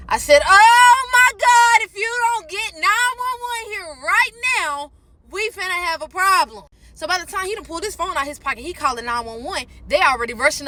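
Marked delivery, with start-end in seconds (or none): 0.5-6.6 high, slow, strained begging; panicked plea. Retold or imitated. Woman in labor pleading with boyfriend to call help, panicked. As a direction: stretch out the words in pain and panic.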